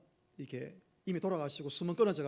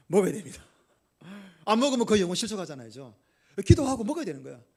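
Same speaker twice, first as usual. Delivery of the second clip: emphatic preaching; 6 dB louder than usual. Acted. Preacher answering his own question.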